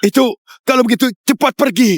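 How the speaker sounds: high-pitched, eager order; sudden excited command; excited urgency. Acted. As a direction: send them off urgently, voice leaping up. Urgent order after startling news.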